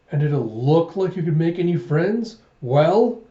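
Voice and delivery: acted reproach. Guardian challenging the child about making friends, reproachful. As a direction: press a child for an answer.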